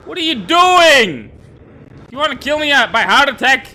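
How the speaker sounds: high, loud panicked shouting; terrified scream. Real, not acted. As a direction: scream after a jumpscare. Jumpscare by monster.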